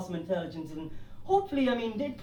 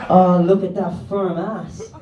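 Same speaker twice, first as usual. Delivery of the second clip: loud, drawn-out, lower leer; genuine pervert; 4 semitones lower, 8 dB louder, slower than usual. Acted. Comedian voices a pervert eyeing a passer-by, leering. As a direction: ogle it slowly and loudly as a creep. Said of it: "there's the genuine pervert, and he's like".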